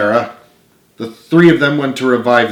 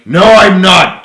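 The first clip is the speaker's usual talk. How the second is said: loud, raised refusal; louder denial; 8 semitones higher, 10 dB louder, slower than usual. Acted. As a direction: push back hard. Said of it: "James's voice got louder".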